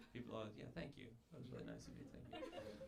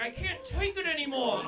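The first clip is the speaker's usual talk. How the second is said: much higher mock-desperate child voice; despairing kid Goofrey; 12 semitones higher, 5 dB louder than usual. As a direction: whine it like a broken little kid. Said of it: "Goofrey's like".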